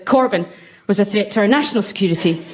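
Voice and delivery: ordinary talk, nothing marked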